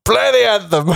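mock French accent. Mocking French accent bit.

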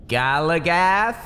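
drawn-out questioning call; slow wary call. Acted. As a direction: call the name slowly, unsure. Bates calls into the room.

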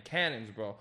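ordinary talk

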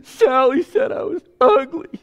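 put-on high, loud whiny sob of a hurt girl; whiny preteen daughter. Voicing a preteen daughter crying to her parent. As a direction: play the wounded middle schooler. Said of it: "and she's like".

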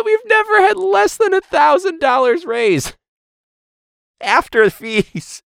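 lightly mocking high voice of the school; mocking quote. Teacher mimicking the school's boast, high mocking voice. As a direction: parrot them in a high mock voice. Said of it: "they said".

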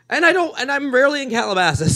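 loud, high indignant retort. Retold or imitated. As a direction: push back, loud and offended. Retold indignant rant.

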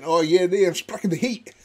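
hammed-up Scottish accent. Shown as a demonstration. Showing co-hosts anybody can do it, mock Scottish accent. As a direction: put on a garbled Scottish brogue. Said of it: "anybody can do the Scottish accent".

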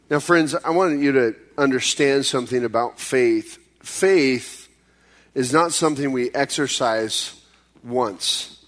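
ordinary talk

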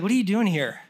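dry imitation of a sarcastic questioner. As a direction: ask as if stating the obvious. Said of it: "it's like a sarcastic".

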